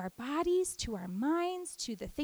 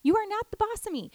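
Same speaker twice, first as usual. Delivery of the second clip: firm telling off; 8 semitones higher than usual. Pretend. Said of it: "just be like".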